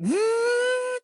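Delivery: high, steady whirring noise of line running out; imitated reel whir. Telling friends how the line was just running, high imitated whir. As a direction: mimic a fishing reel screaming out line. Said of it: "it's just running, like".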